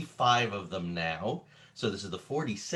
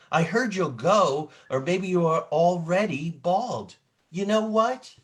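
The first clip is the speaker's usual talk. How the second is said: put-on lively, expressive read-aloud for children, rising higher and louder; dramatic storybook reading; 7 semitones higher, 6 dB louder than usual. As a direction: perform the book like a stage storyteller. Reading picture book aloud dramatically.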